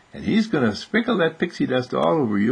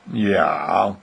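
low, drawn-out sheepish answer; reluctant boy; 5 semitones lower than usual. Acted. Arnold reluctant to share.

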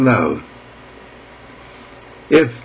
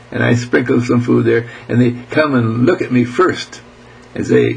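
ordinary delivery

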